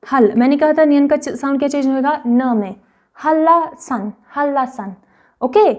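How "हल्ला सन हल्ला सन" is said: "हल्ला सन" with a doubled l; assimilated pronunciation. Shown as a demonstration. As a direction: repeat "हल्ला सन", holding the l. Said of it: "इसको हम पढ़ेंगे".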